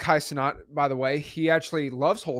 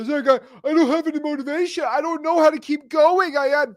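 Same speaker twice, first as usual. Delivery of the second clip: much higher, pleading mock voice; mock whining; 9 semitones higher than usual. Host imitates a viewer begging him for help, high mock whine. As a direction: play the helpless complainer, stammering. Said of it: "you wanna come up to me and say".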